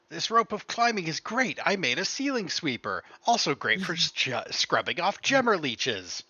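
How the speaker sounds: high, loud upbeat janitor reading his note; cheerful janitor voice. Acted. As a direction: chirp it like a proud handyman. GM reads janitor's note aloud.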